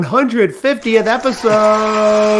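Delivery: slow, drawn-out host announcement; announcer voice. Acted. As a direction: stretch each word like a show host. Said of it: "I'm using my announcer voice".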